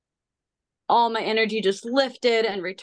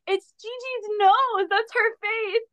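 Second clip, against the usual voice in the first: much higher, slow, squealing with delight; retold giddy wonder; delighted discovery; 14 semitones higher, slower than usual. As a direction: gush over the car's face like a child. Delighted seeing car's face.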